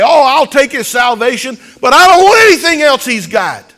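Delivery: loud, high, mocking imitation of a hearer; hammed-up mocking outburst; mock shout. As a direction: shout it out with scorn. Mocking a complacent listener.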